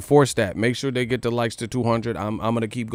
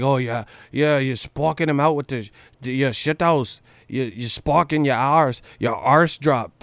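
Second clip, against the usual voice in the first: mock British accent. Vlogger mocking British insults in a put-on British accent. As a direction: taunt it like a British bloke. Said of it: "people don't talk like that".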